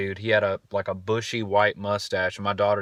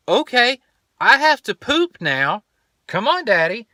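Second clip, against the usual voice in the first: put-on much higher, louder child voice; imitating urgent daughter; 14 semitones higher, 5 dB louder than usual. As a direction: announce it like a bossy little kid. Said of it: "And she says".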